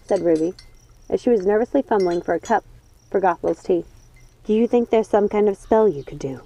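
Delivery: ordinary delivery, nothing marked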